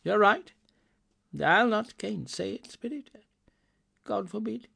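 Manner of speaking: high-pitched, earnest exclamation; old man's heartfelt high cry; Scrooge crying out. A narrator's reading. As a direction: agree in a high, emotional voice. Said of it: "cried Scrooge".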